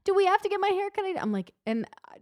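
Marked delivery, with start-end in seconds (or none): 0.0-1.0 put-on high, louder whiny kid plea; pleading kid. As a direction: mimic a begging little boy, pitched way up. Child pleading, retold.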